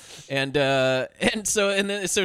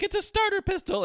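woman's voice. Retold or imitated.

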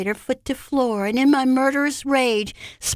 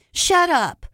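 higher, sudden angry command; angry retort; 7 semitones higher, 4 dB louder than usual. A narrator's reading. Narrator silencing Anthony in a quarrel, angry retort. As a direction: cut the other person off angrily. Acted quarrel, she snaps back.

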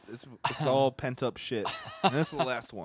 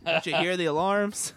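raised mock alarm; 8 semitones higher, 7 dB louder than usual. Acting the scene, loud.

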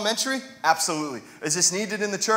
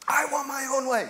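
whiny demand; 7 semitones higher than usual. Retold or imitated. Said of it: "You know what that sounds like?".

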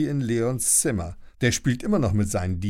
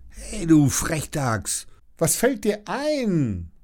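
high, slow, indignant telling-off; slow scolding; 5 semitones higher, slower than usual. Acted. Lilly scolds the snapping baby T-Rex, slow and high. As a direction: scold the cheeky little biter.

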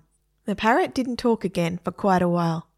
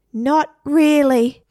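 drawn-out parrot; 7 semitones higher, 7 dB louder, much slower than usual. A narrator's reading. Parrot answering slowly.

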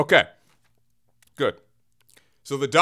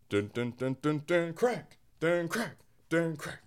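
softer, sing-song cartoon beats; hummed cartoon soundtrack with crack hits; mock cartoon music; Bugs Bunny impersonation; 4 semitones lower, 11 dB quieter, slower than usual. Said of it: "my Bugs Bunny impersonation".

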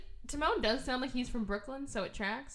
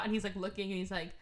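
usual speaking voice

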